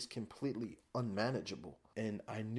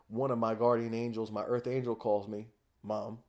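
usual speaking voice

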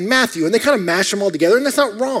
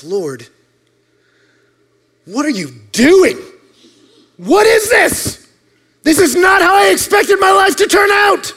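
desperate prayer; 11 semitones higher than usual. Retold or imitated. Boy alone in a field cries out to God, high desperate plea. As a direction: plead with the sky in disbelief. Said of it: "I sat down and I prayed".

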